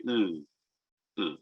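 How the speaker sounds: "u" with tongue on the palate, lips spread, quiet; a soft unrounded "u"; sixth vowel. Shown as a demonstration. Teacher demonstrates the sixth vowel sound. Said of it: "se coloca la lengua sobre el paladar".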